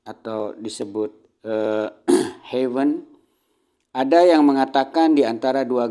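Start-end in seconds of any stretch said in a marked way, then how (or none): none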